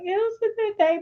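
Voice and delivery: put-on high little-boy voice; young son. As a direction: imitate a puzzled small child answering. Acts out her young son.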